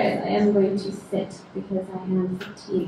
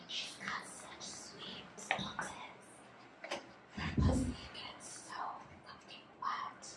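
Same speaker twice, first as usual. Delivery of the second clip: very soft, unvoiced whisper; whispered voices; 15 dB quieter, slower than usual. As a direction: whisper it like voices heard behind closed eyes. Voices overheard in her dream.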